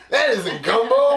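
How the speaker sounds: ordinary talk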